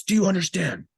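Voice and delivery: sharp barking sergeant question; stern sergeant. Retold or imitated. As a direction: bark it like a drill sergeant. Sergeant grilling him.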